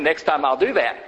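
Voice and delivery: usual speaking voice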